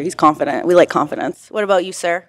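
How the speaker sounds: ordinary delivery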